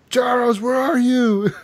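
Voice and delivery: hammed-up drunken shout for a lost buddy; drunk calling out. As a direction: play a wasted man hunting for a friend. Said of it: "you're all slurring your words".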